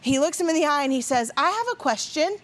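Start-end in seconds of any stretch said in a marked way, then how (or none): 1.4-2.4 lightly voiced small child, higher; eager kid with a question; little boy. Little son speaks to host.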